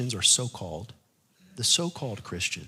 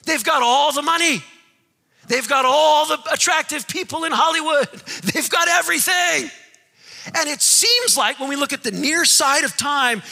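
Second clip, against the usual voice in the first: much higher, louder shouted preaching; full-force preaching; 13 semitones higher, 9 dB louder than usual. Acted. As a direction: thunder at the power of Babylon.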